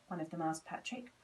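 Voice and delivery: usual speaking voice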